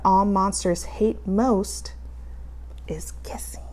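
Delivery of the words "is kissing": whispered ending. A narrator's reading. Final line of story.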